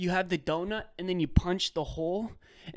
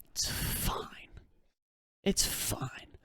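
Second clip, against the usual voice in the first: whispered, resigned; 9 dB quieter, much slower than usual. Retold or imitated. Telling the drive-thru worker it's fine, whispered. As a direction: breathe it out, defeated. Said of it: "I said".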